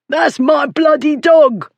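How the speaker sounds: high, slow, strained shout; furious bully's protest; outraged shout. A narrator's reading. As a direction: bellow your claim in a high voice. Bully protests losing his dog.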